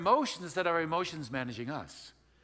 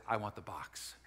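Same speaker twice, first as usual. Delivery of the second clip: low, near-whispered claim; acted-out hushed longing; hushed request; 6 semitones lower, 5 dB quieter, slower than usual. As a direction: confide it as a secret craving. Acting his eager, secretive request.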